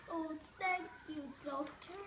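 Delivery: plain reading voice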